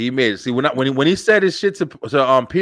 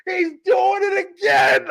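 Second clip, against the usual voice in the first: retold excited shout; 13 semitones higher, slower than usual. Shouting at the TV at home, high and thrilled. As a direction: yell it at the TV, losing it. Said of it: "I was in my fucking living room".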